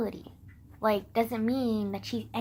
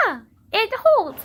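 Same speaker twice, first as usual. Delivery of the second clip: delighted; 10 semitones higher, 9 dB louder than usual. Real, not acted. Relieved it stays in place.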